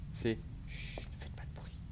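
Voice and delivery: hushed whisper; whispering while hiding. Pretend. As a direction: breathe the words so nobody hears. Sneaking past, hushes himself.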